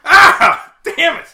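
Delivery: high, loud startled cry; pained yelp. Acted. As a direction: yelp as you hit the ground. Falls to the ground.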